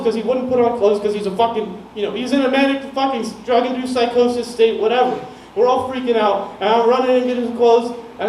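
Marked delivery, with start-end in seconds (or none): none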